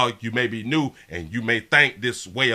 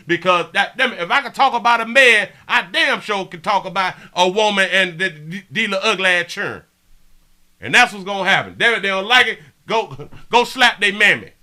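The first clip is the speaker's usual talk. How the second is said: loud, high worked-up tirade; heated rant; 6 semitones higher, 5 dB louder than usual. Real, not acted. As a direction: build the fury, pounding each phrase. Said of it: "let me calm down".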